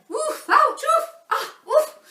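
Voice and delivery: put-on high, sharp yelps of bumped kids; children's yelps. Knocked-over children yelping as the teachers charge past, high squeals.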